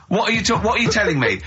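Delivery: usual speaking voice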